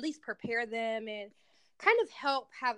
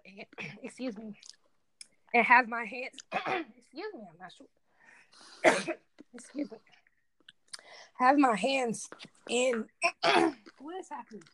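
choking, throat clearing, strained; 3 semitones higher than usual. Real, not acted. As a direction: talk through a real choking fit. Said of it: "I'm like, I'm choking".

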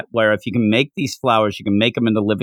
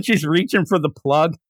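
ordinary talk, nothing marked

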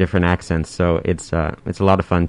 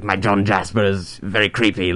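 a lightly voiced creepy John Jasper; character voice demo for the interviewer. Shown as a demonstration. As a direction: put a bit of a sinister character voice on it. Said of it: "like that".

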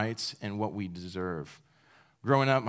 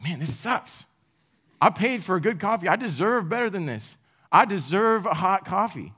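higher, louder entitled grumbling; mock indignant; 7 semitones higher, 4 dB louder than usual. Preacher acting out his own pissy coffee complaint. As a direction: complain like you've been cheated.

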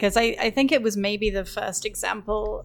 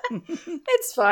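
said through a high laugh; 8 semitones higher than usual. Real, not acted.

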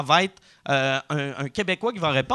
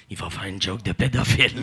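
mocking the audience; 10 semitones lower than usual. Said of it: "tout le monde fait".

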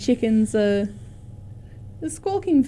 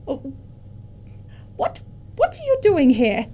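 put-on surprised frail old lady; high old woman, startled; 4 semitones higher than usual. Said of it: "this frail old lady".

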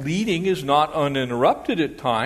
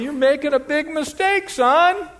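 warning father; 13 semitones higher, 6 dB louder than usual. Retold or imitated. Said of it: "The father's going".

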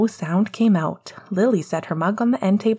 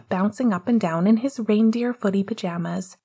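plain reading voice

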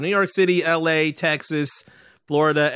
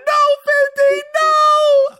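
very high, loud, pleading shout; panicked plea; 7 dB louder, much slower than usual. Retold or imitated. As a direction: yell at him to stop, pitched way up. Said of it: "I'll be like".

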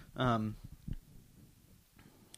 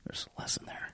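whispered aside; 17 dB quieter, faster than usual. Real, not acted. Wry aside after volume trouble.

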